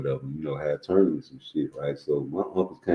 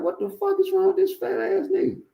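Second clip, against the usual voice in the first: shocked. Retold or imitated. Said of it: "I'm like".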